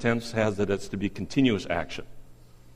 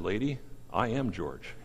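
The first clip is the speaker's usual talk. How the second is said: soft, restrained reply of a retold father; quietly spoken punchline; 8 dB quieter than usual. Weary man answering an admiring lady, quietly. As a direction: deliver the punchline softly, held back. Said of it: "he kept saying very quietly".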